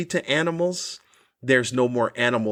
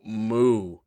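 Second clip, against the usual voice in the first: mock moo; 3 semitones lower than usual. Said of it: "they automatically go".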